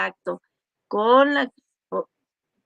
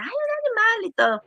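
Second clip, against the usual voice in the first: much higher, scoffing "ay"; belittling scoff; 12 semitones higher than usual. Retold or imitated. Imitating people who mock a woman mourning her cat, high and scoffing. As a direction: shrug off a pet's death.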